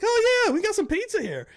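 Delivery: squealy, very high put-on delight; mock enthusiasm. Crew member claiming the pizza before Russ, high mock delight. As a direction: imitate a prankster gleefully playing along.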